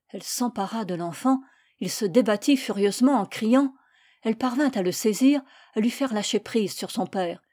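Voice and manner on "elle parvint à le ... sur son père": ordinary delivery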